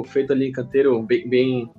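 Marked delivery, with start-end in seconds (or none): none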